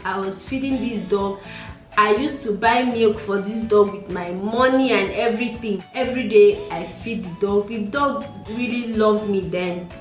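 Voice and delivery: normal talking voice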